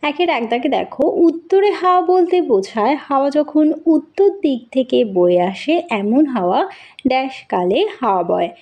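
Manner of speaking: ordinary talk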